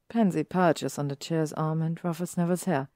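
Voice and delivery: usual speaking voice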